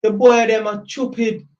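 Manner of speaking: lightly voiced Jamaican grandma, scolding; Jamaican grandma accent. Jamaican grandma pitying prison boys, light Jamaican accent. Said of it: "I'm trying to do Jamaican accent".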